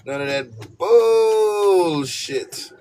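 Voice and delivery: high, loud, very slow drawn-out refusal; hammed-up loud refusal; exaggerated. Shown as a demonstration. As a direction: stretch every word to the limit. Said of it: "You gotta say it with the exaggerations".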